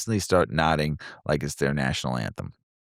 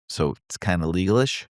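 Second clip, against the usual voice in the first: ordinary talk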